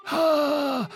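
a short high laughing ha; quick high chuckle. A narrator's reading. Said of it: "lachte Bo".

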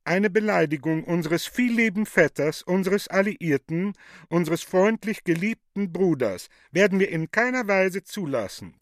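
loudly, with a barbed edge; pointedly loud. Acted. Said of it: "sehr laut zum Alten hinüber anzüglich".

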